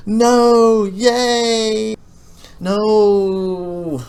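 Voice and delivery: high, loud sing-song swing from no to yay and back; mood swing no yay no. Retold or imitated. Gamer mocking how the mining session felt, high and loud sing-song. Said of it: "this session was very".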